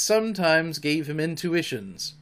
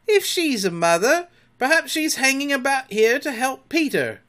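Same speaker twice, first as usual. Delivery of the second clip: higher wary pirate voice; suspicious pirate; 12 semitones higher, 6 dB louder than usual. A narrator's reading. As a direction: voice a doubt, pirate style. Suspicious Starkey speaks.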